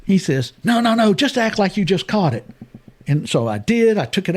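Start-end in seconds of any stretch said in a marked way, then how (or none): 0.6-2.4 urgent coaxing. Retold or imitated. Cameraman talks him into faking it.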